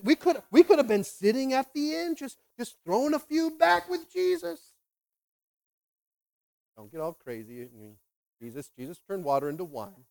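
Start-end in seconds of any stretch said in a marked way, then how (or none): 0.0-4.6 high-pitched mock whine of regret; exasperated complaint. Disciple wishing they had stayed at the inn, exasperated. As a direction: protest what could have been, voice climbing.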